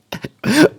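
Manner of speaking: short, high laugh; amused laugh. Real, not acted. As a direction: let out a quick high giggle. Cracks up at the film.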